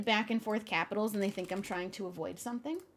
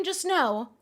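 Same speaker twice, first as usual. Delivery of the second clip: normal talking voice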